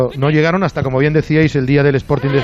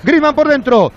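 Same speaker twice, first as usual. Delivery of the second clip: quickening radio match call; live play-by-play; 10 semitones higher, 5 dB louder than usual. Acted. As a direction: call the attack as it builds.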